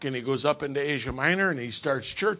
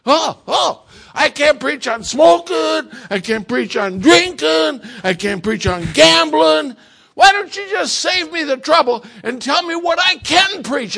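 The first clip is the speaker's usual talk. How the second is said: much higher, louder mock exasperated shout; hammed-up fed-up preacher yelling; frustrated outburst; 12 semitones higher, 13 dB louder than usual. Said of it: "the preacher's frustrated".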